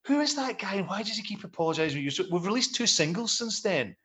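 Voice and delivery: high-pitched, mock-exasperated questioning; baffled complaint. Musician quizzing his manager about apologies, baffled complaint. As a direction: act out puzzled protest, pitched well up.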